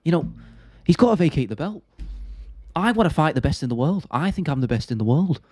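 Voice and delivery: mock-earnest fighter interview voice; Tom Aspinall impression. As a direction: imitate a heavyweight calling for the belt. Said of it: "Tom Aspinall impression".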